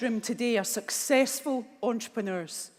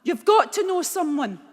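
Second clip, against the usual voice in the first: higher and louder, pressing hard; urgent insisting; 8 semitones higher, 6 dB louder than usual. Retold or imitated. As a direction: demand an answer, shaking her. Mum shaking her, insisting.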